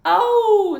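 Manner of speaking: high loud wailing "Oh"; dog wail. Acted. Winn-Dixie wailing outside during the sermon. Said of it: "said Winn-Dixie".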